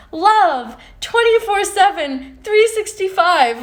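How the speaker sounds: high, loud sing-song parody of positivity; mock the love-and-light crowd; mocking preachy quote. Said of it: "people that are like".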